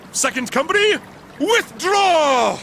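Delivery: parade-ground order, last word shouted; military command. Acted. Officer ordering Second Company to retreat, military command. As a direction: call the troops away, drawing out the order.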